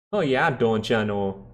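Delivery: slow, mock Minnesota "Oh, yeah, don't ya know?"; Minnesota accent. Streamer joking to chat in a mock Minnesota accent. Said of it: "I love Canadian, like, voices".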